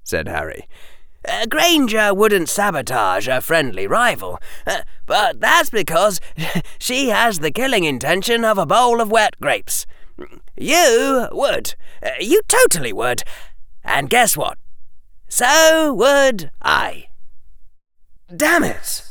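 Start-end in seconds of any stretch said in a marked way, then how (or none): 1.2-17.1 high, innocent schoolboy; Harry voice. Acted. 18.3-19.0 Draco cursing; high, frustrated outburst. Acted.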